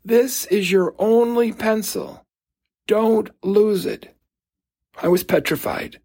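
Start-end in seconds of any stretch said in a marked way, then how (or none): 0.1-4.1 higher, slow, deliberate warning; slow stern voice, each word weighted; stern teacher. Retold or imitated. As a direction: imitate a strict teacher laying down a rule. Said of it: "saying in a very stern voice".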